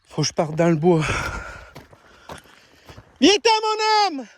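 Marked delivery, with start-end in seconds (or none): 3.2-4.3 long high hail to a lost dog; calling out. Real, not acted. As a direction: call the lost dog from far off. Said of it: "ça fait une heure que je crie".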